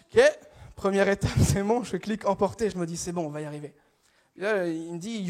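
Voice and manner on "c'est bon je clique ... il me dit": ordinary talk, nothing marked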